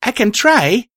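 high-voiced strained exclamation; exclaiming. A narrator's reading. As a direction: push it out with effort, pitch up. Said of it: "I exclaimed".

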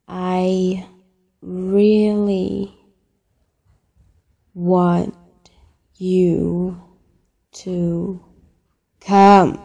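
one word at a time, spaced out; slow right down, pausing after every word; deliberately slow. Acted. Caller repeating it so the listener can hear, deliberately slow. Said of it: "I say it slow".

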